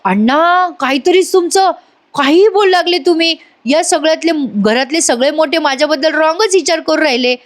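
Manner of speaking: high-pitched, aggrieved objection; acted indignant protest. Raja objecting to Anna's accusation, high indignant protest. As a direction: protest your innocence, pitch held high throughout.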